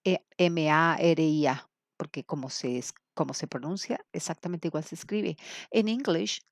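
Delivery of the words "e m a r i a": letters spelled slowly and clearly; careful letter-by-letter spelling. Shown as a demonstration. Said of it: "uno sabe que es".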